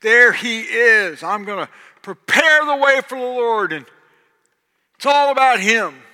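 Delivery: loud high-pitched preacher cry; full-force sermon shout; preaching at full force. Acted.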